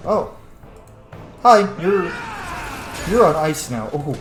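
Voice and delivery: usual speaking voice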